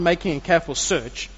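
ordinary delivery, nothing marked

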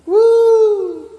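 high, loud celebratory woo; preacher cheering for a new Australian citizen, high and loud; excited whoop. Acted. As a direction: cheer like you just got citizenship.

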